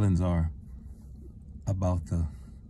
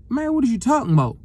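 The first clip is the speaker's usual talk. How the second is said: stunned disbelief; 14 semitones higher, 4 dB louder, faster than usual. Retold or imitated. Disbelief at empty house.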